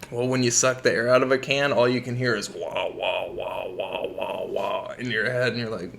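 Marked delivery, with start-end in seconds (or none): 2.9-4.4 low, soft droning wah wah; muffled wah wah drone. Retold or imitated. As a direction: imitate the buzzing in your head, low and soft. Imitating whippet head noise.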